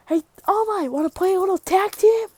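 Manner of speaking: high, cheeky invitation; high-pitched acted offer, rising at the end; playful calling out. Deku cheekily calls to All Might.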